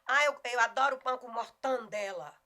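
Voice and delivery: loud example line, MORTADELA as "mor-tan-DE-la"; regional mispronunciation. Acted. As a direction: act a fan of MORTANDELA, nasal TAN in the middle. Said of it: "não deixa de ser regionalismo".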